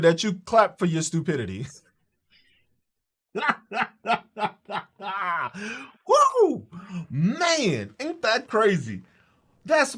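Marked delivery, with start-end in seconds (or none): 3.3-4.5 staccato belly laugh trailing off; real, amused cackle at someone's stupidity; real laughter. Real, not acted. 6.1-6.7 real high whoop after laughing. Real, not acted. Exclaims after laughing hard.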